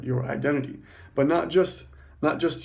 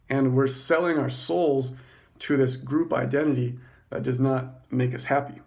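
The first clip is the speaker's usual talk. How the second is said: normal talking voice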